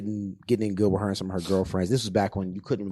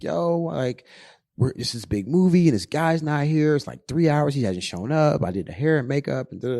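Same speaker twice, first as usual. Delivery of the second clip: higher, mock-upset plea; imitating her crying; 7 semitones higher, 4 dB louder than usual. Girlfriend calling upset from the set, higher mock-distressed plea. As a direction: whine it like she's upset. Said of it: "she called me up crying".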